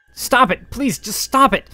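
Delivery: high, loud pleading cry; desperate cry. Acted. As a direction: beg it to stop. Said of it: "our hero cried out".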